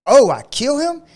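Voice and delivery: high, loud mock-innocent question; retold "oh, really?" challenge; feigned surprise. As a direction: act as if this is news to you, imitating the fighter. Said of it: "He knew he didn't kill him".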